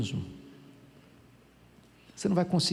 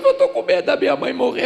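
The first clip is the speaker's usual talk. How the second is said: a hint of a tearful voice, pitched up; crying confession; 10 semitones higher, 7 dB louder, faster than usual. A hint of tearful seminarian confessing his fear. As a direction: whimper it like a scared young man. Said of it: "E ele chorou".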